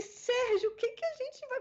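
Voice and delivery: high-pitched retold plea for help; mock panic. Dubber asking the director for help, in mock panic.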